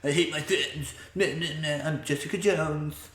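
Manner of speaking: lightly whiny put-on voice; Jessica Jones whiny voice. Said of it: "That was also my Jessica Jones impression".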